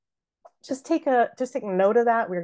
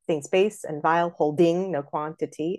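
"holding" misstressed as hol-DING; wrong-stressed hol-DING in the line; forced iambic stress. Shown as a demonstration. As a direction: keep a rigid da-DUM beat through "holding". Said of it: "we hear that it's not right".

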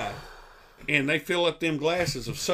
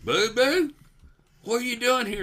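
groggy patient voice; 9 semitones higher, 4 dB louder than usual. Retold or imitated. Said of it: "Biggins goes".

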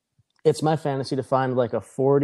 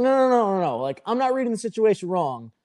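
higher-pitched protest, no's tumbling out; insistent denial; 7 semitones higher than usual. Retold or imitated. Patron tells bouncer he isn't misreading it, insistent denial. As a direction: imitate arguing back, voice jumping up.

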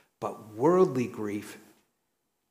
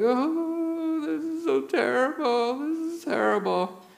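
high, wailing fake sob, much higher and louder; mock crying wail; 3 dB louder than usual. Little boy fake-crying so his mother won't spank him. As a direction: ham up a child's crocodile tears. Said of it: "I was crying".

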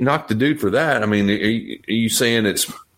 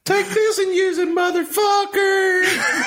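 high, strained comic shout; hammed-up wrestler bellow; mock yell; much slower than usual. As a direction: holler it over the top, dragging out the last word. Co-host joins the acted exchange.

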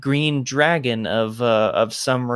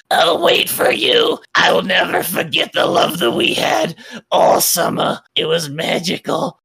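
lovelorn dragon's vow; 4 semitones higher, 4 dB louder than usual. Acted. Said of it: "she's just like".

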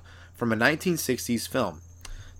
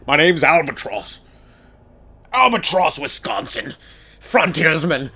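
slow, self-important introduction; lightly voiced frontiersman; 2 semitones higher, slower than usual. A comic playing a frontiersman named Albatross, slow and proud. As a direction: announce yourself like a rugged woodsman.

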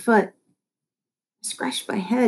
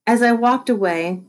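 ordinary delivery, nothing marked

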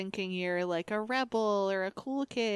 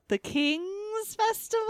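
high theatrical voice; 12 semitones higher, slower than usual. Pretend. Mock royal announcement.

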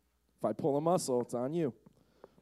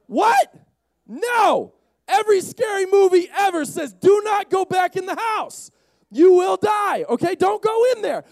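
high, loud disbelief; alarmed shouting; 14 semitones higher, 8 dB louder than usual. Acted. Arguing with the friend who checks out ghosts, alarmed. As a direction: shout a frantic warning.

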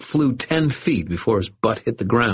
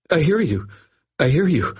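frightened agreement; 9 semitones higher than usual. A narrator's reading. Dangling bully frantically agreeing. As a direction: babble the yes in panic.

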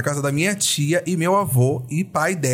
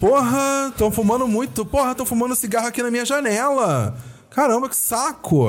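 strong carioca accent, pitched higher; heavy Rio accent; 8 semitones higher than usual. Shown as a demonstration. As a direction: lay the Rio accent on thick. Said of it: "Faz de novo com o sotaque mais carioca".

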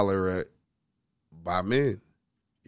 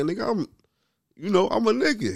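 indignant high; 10 semitones higher than usual. Retold or imitated. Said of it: "I'm like".

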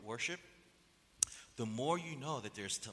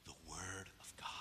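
a breathless, voiceless whisper; 9 semitones lower, 14 dB quieter than usual. Acted. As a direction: let it out as a hushed secret. Answers own question, hushed.

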